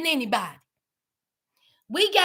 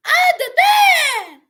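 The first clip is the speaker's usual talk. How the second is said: even higher, slow, louder cry of disbelief; louder incredulous shout; 9 semitones higher, 6 dB louder, slower than usual. Retold or imitated. Replaying Blaine on TV, higher slow incredulous shout. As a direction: push the disbelief further, slow and loud. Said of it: "I said".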